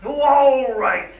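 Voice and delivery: loud, high, drawn-out concession; exasperated giving in. Acted. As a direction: give in noisily, stretching the words. Gives in to the argument.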